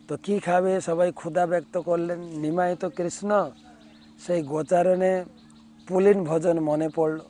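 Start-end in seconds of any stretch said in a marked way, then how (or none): none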